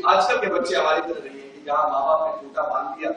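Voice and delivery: ordinary talk, nothing marked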